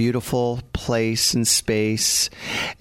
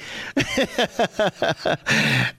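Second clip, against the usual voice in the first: long high-pitched cackle at own joke; laughing; 7 semitones higher than usual. Real, not acted. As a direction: burst out in a high, rapid laugh.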